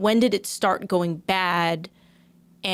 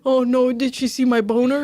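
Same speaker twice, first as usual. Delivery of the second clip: higher, louder teasing imitation of panic; mock embarrassed panic; 5 semitones higher, 5 dB louder than usual. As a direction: blurt a hammed-up worried thought. Acts out his embarrassed inner thought.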